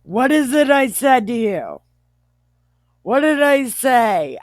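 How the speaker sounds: high, loud mock scolding; insistent demand. Joe insisting to his brothers they're spies, demanding. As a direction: demand an answer with hammed-up force.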